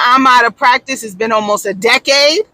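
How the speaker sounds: usual speaking voice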